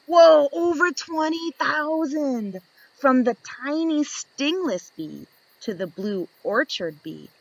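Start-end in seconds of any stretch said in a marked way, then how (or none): none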